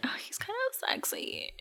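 hushed, voiceless whisper; soft, secretive imitated whisper; whispered admiring aside. Heroine's private aside in retelling.

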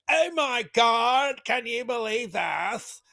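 high, slow, drawn-out mock gasp of disbelief; sarcastic high-pitched fake shock; mock whiny outrage. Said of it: "who wanna whine and cry".

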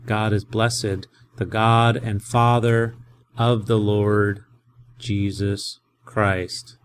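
slow, weighty reading word by word; slow teacher reading of the verse; slow deliberate translation. Shown as a demonstration. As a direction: lay each word down slowly. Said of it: "teacher translates slowly".

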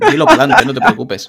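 loud, high push to speak up; mock urging shout. Urging a guest to speak, loud and high. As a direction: egg him on, half shouting.

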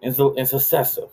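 high-pitched mom impression; imitated mom. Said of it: "She's like".

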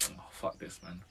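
whispered exasperated give-up. Retold or imitated. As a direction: breathe it out, fed up. Retold frustration, voiced as quote.

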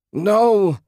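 very high, very loud scream of loss; husband screaming as his wife vanishes; anguished scream. A narrator's reading. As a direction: scream it from the gut. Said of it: "Ted screamed".

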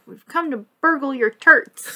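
Greg. Retold or imitated. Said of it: "and Greg is like".

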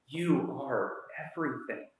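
soft, slow, hushed affirmation; tender reassurance. Retold or imitated. Longing to hear someone say it, soft and slow. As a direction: gently tell them they're everything. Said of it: "and to say".

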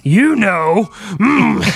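coy high voice; sheepish, coy. Retold or imitated.

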